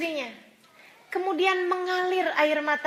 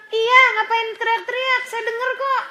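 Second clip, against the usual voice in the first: 1 annoyed retort; 8 semitones higher, 6 dB louder than usual. Pretend. Mocking wife snapping at shouting husband.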